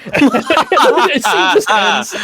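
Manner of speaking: words squeezed out through laughter; can barely get it out for laughing. Real, not acted. Friend cracking up describing a movie scene, laughing.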